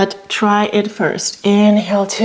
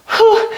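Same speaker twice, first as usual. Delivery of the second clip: effortful whew; 14 semitones higher than usual. Real, not acted. Balancing on one leg, effort.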